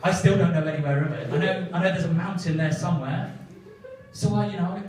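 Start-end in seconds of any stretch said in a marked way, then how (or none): none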